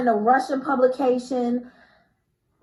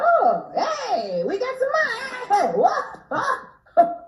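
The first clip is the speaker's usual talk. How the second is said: high, sudden squeal of bosses spotting money; mock excited studio bosses; 13 semitones higher, slower than usual. Studio execs noticing overseas money, high mock excitement. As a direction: ham up the studio suddenly thrilled. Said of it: "the studio and everyone was like".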